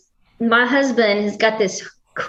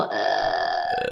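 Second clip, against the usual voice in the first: vocal fry; 7 dB quieter than usual. Shown as a demonstration. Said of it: "vocal fry".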